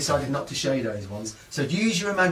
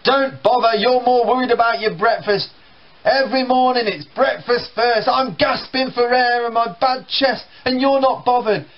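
higher, louder complaining father; grumbling dad; 9 semitones higher, 4 dB louder than usual. Retold or imitated. Dad scolding his son over breakfast, louder and higher.

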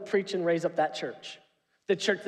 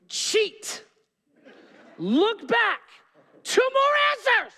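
high, loud, strained scolding; laughing mock outburst at his son; exasperated parent; 13 semitones higher, 4 dB louder, slower than usual. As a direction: pretend to lose it over the homework. Scolding son during homework.

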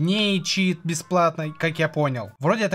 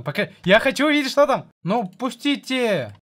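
impatient and higher, rushing; eager; 6 semitones higher, 3 dB louder than usual. Real, not acted. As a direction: push to see it now. Eager to open the page. (нетерпеливо и выше)

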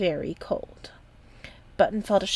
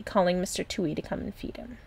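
ordinary delivery, nothing marked

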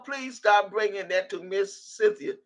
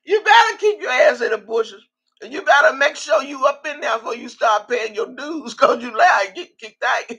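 louder, higher scolding with laughter near; fired-up warning; 5 semitones higher, 5 dB louder than usual. Real, not acted. Telling viewers to stay hidden, loud fired-up warning. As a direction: lay down the law to the viewers, loud.

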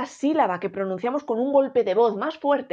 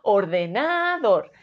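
"ordenador" with long, held NA; or-de-NÁÁ-dor, slow; stress on third syllable; 2 semitones lower, slower than usual. Shown as a demonstration. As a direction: stretch NA in "ordenador". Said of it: "Si fuese na".

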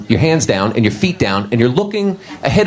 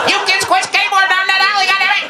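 put-on much higher cranky old man yelling; grumpy old man. Comic as an old man yelling at skateboarding kids. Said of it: "I was like a little old man looking at her".

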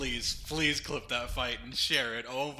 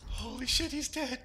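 high, hushed amazement; stunned disbelief; 10 semitones higher than usual. Real, not acted. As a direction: gasp it out in shock. Boss defeated, disbelief.

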